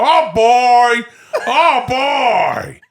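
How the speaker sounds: big drawn-out actor impression; De Niro doing Al Pacino. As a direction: belt out the catchphrase as a hammy impression. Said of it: "My friend Al Pacino".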